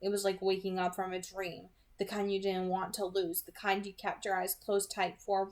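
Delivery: ordinary delivery, nothing marked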